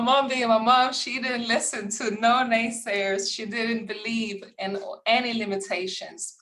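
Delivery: normal talking voice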